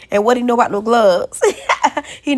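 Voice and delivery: loud laughing question; cracking up in disbelief; laughing high and loud. Real, not acted. Laughing at son eating without gloves.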